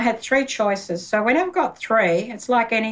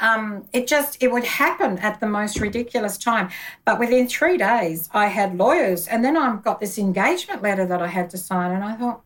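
ordinary talk, nothing marked

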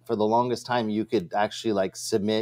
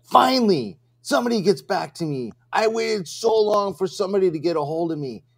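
caller's relieved rant; 7 semitones higher than usual. Retold or imitated. Retelling the caller's phone greeting, high mock relief. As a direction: voice the caller as if finally heard. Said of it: "He's like".

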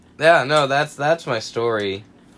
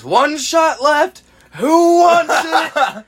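high, slow singsong mom voice; party mom offering; 8 dB louder, much slower than usual. Retold or imitated. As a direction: dangle the last shot teasingly.

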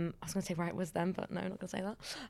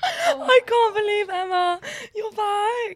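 excited; 12 semitones higher, 6 dB louder, slower than usual. Real, not acted. Overjoyed at friend's return.

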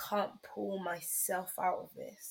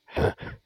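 laughing; 7 dB louder than usual. Real, not acted. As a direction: burst of laughter. Laughing fit during argument.

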